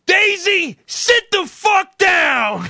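high mock shout at the dog; very slow, high, loud mock yell; imitated yell. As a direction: bellow at the dog like a tough guy. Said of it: "he had to yell".

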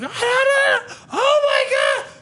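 very high squealed mock crying; gasping, overjoyed put-on wail; imitated mind-blown child. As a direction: imitate a kid losing it over presents. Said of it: "We fucking blew his mind".

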